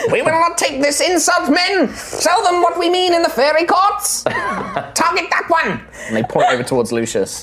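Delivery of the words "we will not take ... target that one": high, proud tiny commander; rally the troops in a squeaky voice; fairy captain. Acted. Fairy captain rallying his men against Lucius, high and proud.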